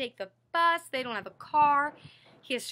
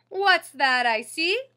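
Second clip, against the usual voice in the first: bus driver's greeting; 2 semitones higher, 8 dB louder, slower than usual. Acted. Same line reread.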